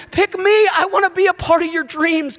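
high, excited plea to be chosen; eager volunteering. Acted. Disciples volunteering to God for his dreams, eager high-pitched plea. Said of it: "They volunteer".

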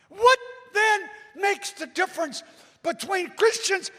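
high, loud urgent pulpit shout; fired-up preacher at full volume; full-force preaching. Real, not acted. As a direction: punch out each short phrase to the congregation.

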